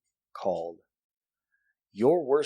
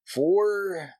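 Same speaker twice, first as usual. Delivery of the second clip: slow, louder, higher announcer voice; radio voice; 8 semitones higher, 4 dB louder, much slower than usual. Pretend. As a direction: boom it out like a DJ. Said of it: "I got my radio voice".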